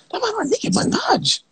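a very high, squeaky shriek of disbelief; thrilled high-pitched squeal; excited squeal. Real, not acted. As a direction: shriek it out like you can't believe it.

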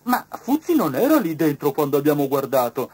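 the beetle objects loudly; beetle's voice. A narrator's reading. Beetle objecting to the inspector, loud. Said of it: "disse Scarabeo".

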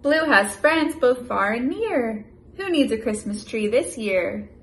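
lively, loud picture-book read-aloud; animated storybook voice for a little one; reading to a child. Acted. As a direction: read it big and bouncy for a toddler.

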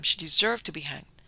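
ordinary delivery, nothing marked

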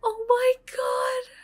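high slow shout of horror; horrified shout. Real, not acted. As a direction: scream out in dismay. Worse disaster.

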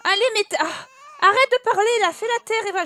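fed up and shrill-edged; exasperated scolding. Real, not acted. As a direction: scold them loudly, out of patience. Snapping at hysterical, screaming Sims.

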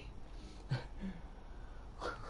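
quiet breathy chuckle; a small breathy laugh in disbelief; soft airy laugh. Real, not acted. Streamer laughing at a viewer's story, quiet airy chuckle. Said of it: "I'm laughing because".

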